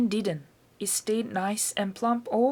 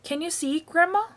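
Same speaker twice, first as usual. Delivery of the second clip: higher, louder call up to the roof; boy shouting up; 8 semitones higher, 4 dB louder than usual. A narrator's reading. Boy calling up to grandma on the roof, shout. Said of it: "Georgie shouted".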